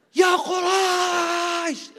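long, drawn-out high shout; shout at the top of his voice. Acted. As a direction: bellow it across the city. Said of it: "فَكَانَ يُصَرِّخْ بِأَعْلَى صَوْتِهِ".

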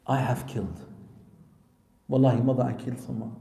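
low, quiet retold confession; subdued confession. Crying son confessing to his mother, subdued. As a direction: let it sink low and soft, as if admitting guilt.

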